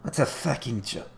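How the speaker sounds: a lightly voiced, failed Rasmus mock; Rasmus impression, retried. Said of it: "I can't do an impression of Rasmus".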